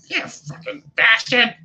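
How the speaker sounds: high, loud, slow curse; drawn-out angry squawk; furious goblin. Acted. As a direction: spit out each word in goblin rage.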